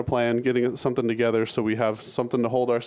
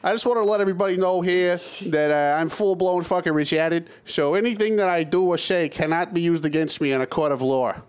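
pitched-up dopey mock voice; goofy put-on voice; 4 semitones higher than usual. Cohost opening the show with a pitched-up dopey character. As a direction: ham up a dopey guy announcing himself.

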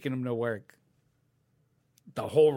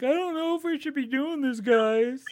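nervous friend voice; 12 semitones higher than usual. Retold or imitated.

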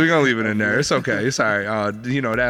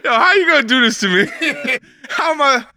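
high, loud laughing disbelief; 12 semitones higher, 6 dB louder than usual. Real, not acted. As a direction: crack up while talking. Cracking up at the bars.

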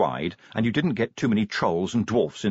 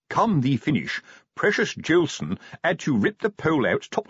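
raised-pitch sergeant spinning a report; scandalised sergeant; 5 semitones higher than usual. Acted. Sergeant telling corporal of the rescue, scandalised. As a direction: recount the indignity with pompous restraint. Sergeant recounts incident at length.

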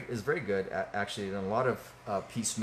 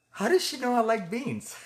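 much higher, naive mock-innocent question; innocent puzzled wife; 11 semitones higher, 3 dB louder than usual. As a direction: play the wife as sweetly clueless. Wife's deadpan joke.

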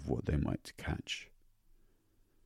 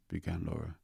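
plain reading voice

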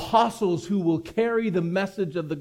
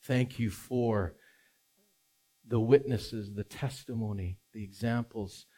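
prayer; 6 semitones lower, 6 dB quieter than usual. Acted. Pastor prays before sermon.

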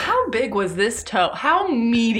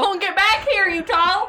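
high mock Southern scolding; Southern accent; 9 semitones higher than usual. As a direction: call it back with a Southern twang. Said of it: "it was the Southern accent".

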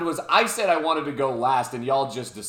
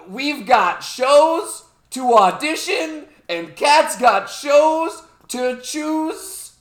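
announcer voice; 8 semitones higher, 5 dB louder, slower than usual. Acted. Announcing the game.

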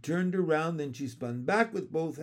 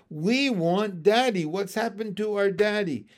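put-on loud, raised childish demand; narrated scream; 6 semitones higher, 7 dB louder than usual. A narrator's reading. Froglets in the well, loudly crying for their father. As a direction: cry out like upset little ones. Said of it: "screamed the froglets".

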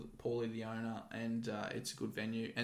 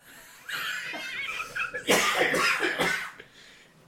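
laughing cough; 6 dB louder than usual. Real, not acted. Laughing at own story.

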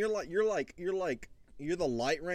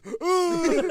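high, loud pained howl; groan of pain; 13 semitones higher, 8 dB louder than usual. Retold or imitated. Husband defending himself to wife, acted pained howl. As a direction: reenact a wordless cry of agony. Acting wordless cramp pain.

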